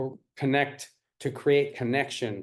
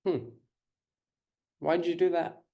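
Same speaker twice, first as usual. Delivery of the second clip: gentle curious question. Shown as a demonstration. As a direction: ask kindly to understand. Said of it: "there's a difference between".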